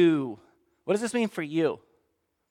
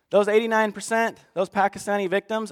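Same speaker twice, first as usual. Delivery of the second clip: ordinary talk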